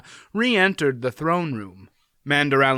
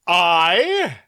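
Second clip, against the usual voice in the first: amazed; 6 semitones higher, 6 dB louder than usual. A narrator's reading. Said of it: "seemed amazed".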